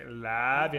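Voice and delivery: "Love ya" with a stretched first word; fixed cadence. Retold or imitated. Whole group echoing the phone sign-off, rote "Love ya". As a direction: draw out "Love", tuck "ya" on the end. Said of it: "in the same exact cadence".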